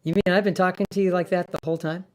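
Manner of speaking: ordinary talk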